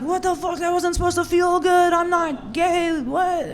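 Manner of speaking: high-pitched indignant panic; mock mad guy. Imagined guy mad that he came, high-pitched outrage. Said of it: "He's gonna be so mad".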